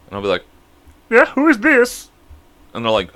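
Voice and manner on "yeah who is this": high drawn-out put-on phone voice; changed voice. Prankster answering a spam caller, high disguised voice. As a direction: disguise your voice, high and stretched. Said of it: "I change it all".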